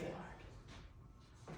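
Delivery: ordinary delivery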